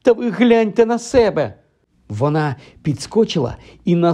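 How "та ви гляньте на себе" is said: high, exasperated outburst; indignant shriek. A narrator's reading. Hermione to the boys caught by the plant, shrieking. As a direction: scold them in a raised pitch. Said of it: "заверещала Герміона".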